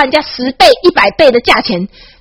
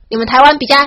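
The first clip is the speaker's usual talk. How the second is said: ordinary talk, nothing marked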